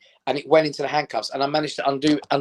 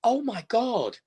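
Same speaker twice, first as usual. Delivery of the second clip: imitating shock; 8 semitones higher, 3 dB quieter, much slower than usual. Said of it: "And he went".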